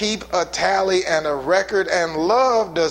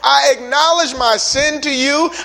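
higher and louder scripture proclamation; full-force preaching; 5 semitones higher, 4 dB louder than usual. Real, not acted. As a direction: proclaim the verses, climbing.

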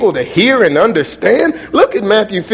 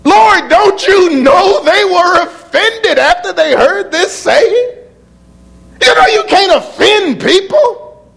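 preaching shout; 7 semitones higher, slower than usual. Real, not acted. Preacher shouting to his church, high laughing shout.

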